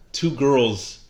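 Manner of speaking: normal talking voice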